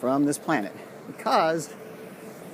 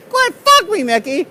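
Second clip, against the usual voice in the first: mock pleading; 13 semitones higher, 8 dB louder than usual. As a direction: beg Mickey in a hammed-up voice. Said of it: "retort continues".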